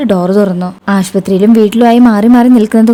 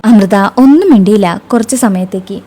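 usual speaking voice

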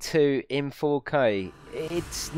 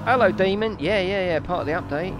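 higher-pitched, matey put-on voice; put-on chatty voice; 6 semitones higher than usual. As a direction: pitch it up like an over-keen mate egging someone on. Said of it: "don't know why I'm talking like that".

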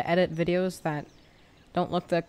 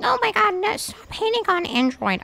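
very high whiny viewer voice; mocking commenter voice; 3 dB louder than usual. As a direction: mimic an offended Android fan. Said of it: "and you think".

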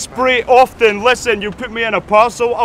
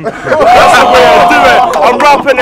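laughing boast; 2 semitones higher, 7 dB louder than usual. Real, not acted. Rapper laughing, crowd hyped.

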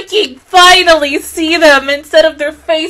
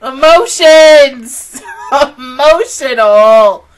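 slow, drawn-out tearful cry; hammed-up wail; 6 dB louder, much slower than usual. Real, not acted. Overwhelmed fan declaring her feelings, hammed-up wail. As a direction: stretch each word into a comic wail. Wailing over the emotional episode.

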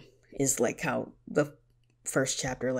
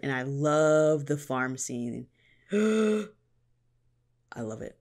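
normal talking voice

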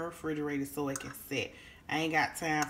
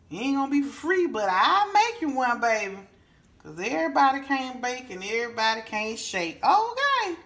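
high, loud, sassy sales pitch; sassy offer; 6 semitones higher, 6 dB louder than usual. Real, not acted. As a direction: tease them that it won't be free. Sassy offer to bake for viewers.

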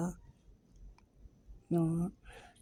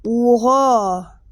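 stunned oha; 3 semitones higher, 8 dB louder than usual. Real, not acted. Gamer stunned by the cup draw, loud amazed oha. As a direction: let the shock blow out of you.

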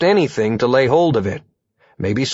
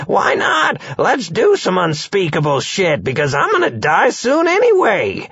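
put-on high, cackly old crone; 7 semitones higher than usual. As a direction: careless old hag voice. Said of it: "And the crone says".

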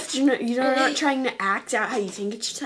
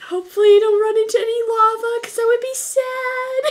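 high, bright YouTuber voice; chirpy and sweet, much higher; Cupquake impression; 9 semitones higher, 3 dB louder than usual. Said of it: "Uh, Tiffany".

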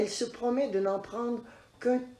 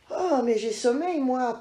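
put-on little girl's voice; 5 semitones higher, 5 dB louder than usual. Goldilocks voiced, sleepy exclamation.

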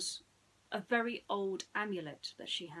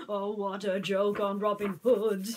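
gloating prince; 9 dB louder than usual. Acted.

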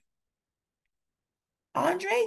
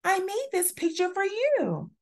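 proud; 10 semitones higher than usual. A narrator's reading. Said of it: "Andre proudly holds up".

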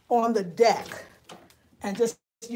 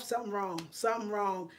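usual speaking voice

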